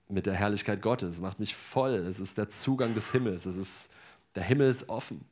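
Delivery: usual speaking voice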